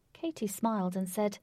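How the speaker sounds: ordinary delivery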